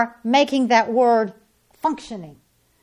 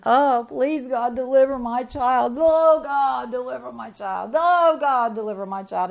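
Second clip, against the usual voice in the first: mock pleading prayer. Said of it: "they'll beg and they'll plead".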